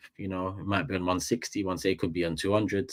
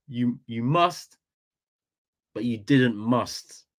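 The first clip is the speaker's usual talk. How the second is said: slower, drawn out retry of Saeed's line; quoting Saeed, second try; 3 semitones higher, slower than usual. Retold or imitated. Streamer retrying friend Saeed's line, slow careful imitation. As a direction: stretch each word to catch how he said it. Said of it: "I can't even say it the way you said it".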